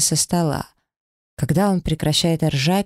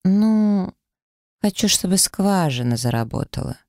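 duck lips; 5 semitones higher than usual. Acted. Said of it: "Делаю губы уточкой и тяну".

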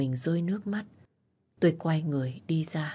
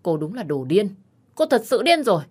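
higher, louder furious retort; angry shouting; 4 semitones higher, 5 dB louder than usual. Acted. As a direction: drive her out with a yell. Heated quarrel with Vi. (quát lớn giận dữ)

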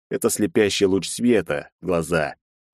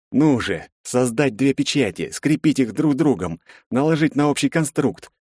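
raised-pitch, pressing inner monologue; urgent self-urging; 7 semitones higher than usual. A narrator's reading. Hidden mage races to finish his spell, urgent. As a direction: push through the steps under pressure.

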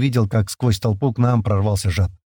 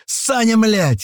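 high-pitched yelled name; yelling; 11 semitones higher, much slower than usual. A narrator's reading. April running up to his friend, yelling. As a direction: yell it, pitch jumping up. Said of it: "заорал Апрель".

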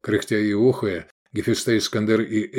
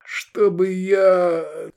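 high, very slow pained moan; groaning; 9 semitones higher, much slower than usual. A narrator's reading. Hungover Iskander swearing off drink, drawn-out groan. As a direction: moan it out in misery. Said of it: "застонал Искандер".

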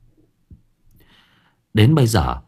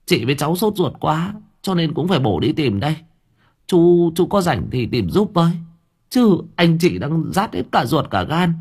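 choked up; 7 semitones higher than usual. A narrator's reading. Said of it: "giọng nghẹn ngào".

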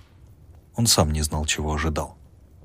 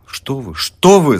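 loud, slow, alarmed refusal; flustered protest; 8 semitones higher, 9 dB louder, slower than usual. A narrator's reading. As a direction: wave it away in alarm. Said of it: "замахал руками студент". (взволнованный отказ)

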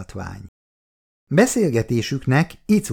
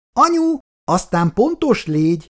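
higher call from the next room; 11 semitones higher, 3 dB louder than usual. A narrator's reading. Icu calling out to her mother from the bathroom, higher call. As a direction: shout it through the bathroom door. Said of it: "kiabált kifelé Icu".

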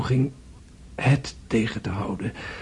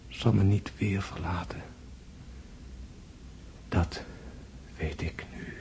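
dying away to a breath; fading whisper; 3 semitones lower, 9 dB quieter than usual. Acted. André finishing his dream to Natasja, fading to a whisper. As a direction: let it fade out.

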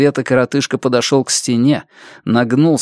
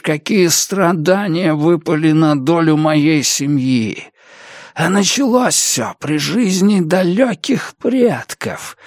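slow, higher-pitched, anguished bitterness; страдающий коротышка; 5 semitones higher than usual. Acted. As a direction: pour out the family's suffering, drawn out. (медленно, с надрывом и горечью)

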